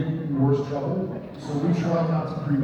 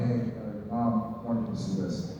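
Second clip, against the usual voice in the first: mock somber; 4 semitones lower, 7 dB quieter than usual. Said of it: "it's very serious, and it's kinda somber".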